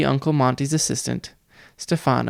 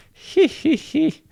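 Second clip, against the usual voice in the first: very high nasal-free titter, sneering; high mocking giggle "he he he"; much slower than usual. A narrator's reading. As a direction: cackle up high at the brats. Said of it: "Nero laughed".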